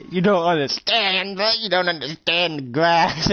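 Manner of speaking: slow, high, stretched-out lament; hammed-up despair, dragging out the words; overwrought anguish. Acted meltdown over the lawn.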